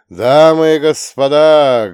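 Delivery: booming, slow ring announcer call; arena announcer. Acted. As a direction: roar it out to the whole arena. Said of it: "грохочет над амфитеатром арены голос ринг-анонсера".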